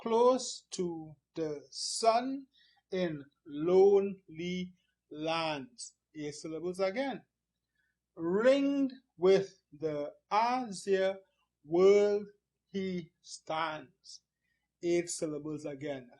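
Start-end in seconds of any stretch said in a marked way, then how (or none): 0.0-5.9 slow, evenly separated syllables. Shown as a demonstration. Teacher counting syllables for the class, slow and separated. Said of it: "Let's look at the second line". 8.2-14.2 slow, drawn-out, separated syllables. Shown as a demonstration. Teacher counting eight syllables, slow and deliberate. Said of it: "Every line has eight syllables".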